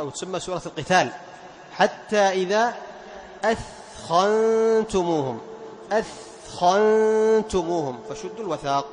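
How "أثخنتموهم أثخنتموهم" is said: slow chanted أثخنتموهم with the ث clearly unvoiced before خ; tajweed recitation of أثخنتموهم; clear tha before kha. Shown as a demonstration. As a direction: recite أثخنتموهم slowly, ث breathy and distinct. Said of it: "فتظهر وتبين الثاء عند الخاء".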